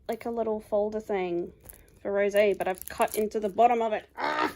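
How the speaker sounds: usual speaking voice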